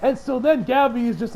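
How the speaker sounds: ordinary talk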